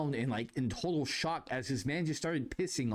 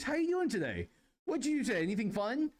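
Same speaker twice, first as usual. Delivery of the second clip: high, chirpy customer service greeting; high-pitched checkout small talk; 10 semitones higher than usual. Retold or imitated. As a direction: greet them in a much higher service voice. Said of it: "Use my customer service voice".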